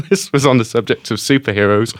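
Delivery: usual speaking voice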